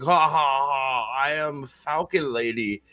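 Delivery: slow staged laugh and grand announcement; hammy villain reveal, drawn out; mock villainous Falcon Lady. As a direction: intone it with a fake evil laugh. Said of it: "Just be like".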